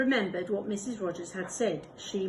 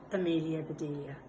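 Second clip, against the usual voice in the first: ordinary delivery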